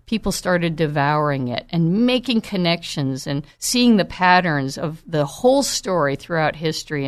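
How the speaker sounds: usual speaking voice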